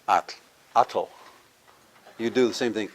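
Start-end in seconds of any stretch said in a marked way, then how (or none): 0.1-1.1 Atl as AH-tul, a voiced vowel before the L; deliberate mispronunciation. Shown as a demonstration. Teacher showing the wrong way, deliberate mispronunciation. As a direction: finish Atl like the end of bottle. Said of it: "I'm gonna mispronounce".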